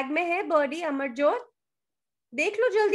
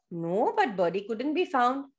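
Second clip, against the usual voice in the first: ordinary delivery, nothing marked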